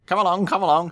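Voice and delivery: high, eager call; high-pitched call to hurry; calling to the coaches. Acted. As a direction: urge them on. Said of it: "Thomas called to the coaches".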